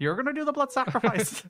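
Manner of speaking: high, hammed-up Northern Irish; Barry. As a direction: hand out orders in mock Northern Irish. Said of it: "That's our great Northern Ireland accent".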